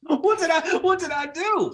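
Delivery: very high, baffled protest; mock indignation. Mock victim of roast joke.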